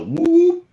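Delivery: long, high-pitched imitated whoop. Witness imitating a call heard at night, long high whoop. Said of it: "it was long".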